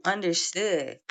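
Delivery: ordinary delivery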